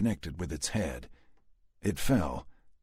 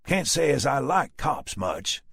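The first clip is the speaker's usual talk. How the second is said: slow, loud Texan grumble; Southern drawl; 8 semitones higher, 5 dB louder than usual. A narrator's reading. Dallas to the cop, slow Southern drawl. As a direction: drawl it out, unimpressed.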